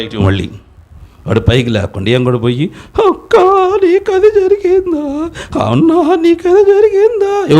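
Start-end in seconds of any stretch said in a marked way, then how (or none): 3.1-7.6 high, slow wailing lament; mock wailing mourner. Preacher mocking relatives who sit and wail, mock wailing. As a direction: parody a weeping relative. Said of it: "బో అని ఎడుతా కూర్చుంటారు".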